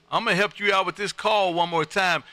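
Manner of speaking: ordinary delivery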